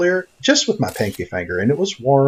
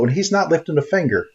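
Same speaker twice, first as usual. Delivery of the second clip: ordinary talk, nothing marked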